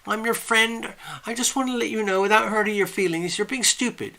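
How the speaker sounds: high, sing-song friendly scolding; affectionate chiding. Shown as a demonstration. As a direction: tease a good friend kindly, arm round the shoulder. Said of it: "affectionate, kind way of putting your arm around the shoulder".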